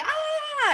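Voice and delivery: put-on high squeal of a thrilled kid; excited squeal. Mother imitating her son's thrilled high squeal. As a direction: shriek with delight like her son. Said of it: "And he's like".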